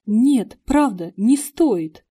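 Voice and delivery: breathless loud protest; gasping for air. Acted. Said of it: "ловя ртом воздух".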